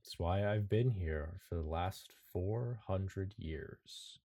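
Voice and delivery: slow, soft menacing reveal; ancient sinister stranger. Acted. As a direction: draw it out, hushed and ominous.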